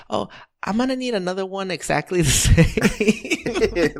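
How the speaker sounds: put-on high old-lady voice breaking into a laugh; imitated old lady. Old lady adding to her finished order, high mock voice. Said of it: "after she's done with her order".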